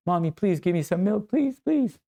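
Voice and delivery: put-on pleading child, climbing high on the last please; begging little boy. Good boy begging his mommy for milk, pleading. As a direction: beg in a hammed-up high voice. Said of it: "we start to beg like good boys".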